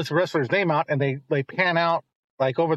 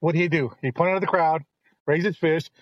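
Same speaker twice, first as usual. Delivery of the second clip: normal talking voice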